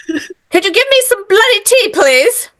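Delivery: put-on British accent. Host voices the mouse asking for tea, British accent. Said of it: "in his best little British accent".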